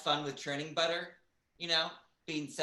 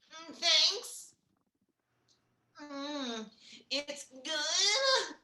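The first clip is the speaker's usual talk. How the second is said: very high, moany porn-star voice; hammed-up porn voice for a coffee order; porn accent; 12 semitones higher, much slower than usual. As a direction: squeal thanks in a mock porn accent. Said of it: "do that in your everyday life".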